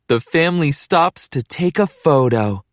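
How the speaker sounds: ordinary delivery, nothing marked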